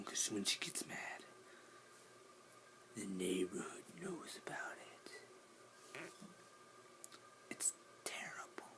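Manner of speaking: hushed secret whisper. Real, not acted. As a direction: keep it down, whisper. Whispering about mom.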